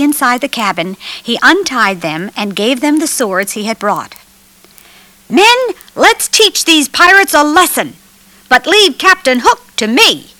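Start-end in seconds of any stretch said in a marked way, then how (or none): 5.3-10.3 higher-pitched, bold battle orders; lightly voiced young commander rousing the troops; rallying leader. A narrator's reading. Peter orders the freed children into battle, rallying higher voice.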